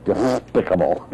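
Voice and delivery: slow, slushy lisping duck; cartoon duck lisp; Daffy Duck. Shown as a demonstration. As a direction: splutter through the word, drawing it out. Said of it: "Every, every time he'd talk, he'd say".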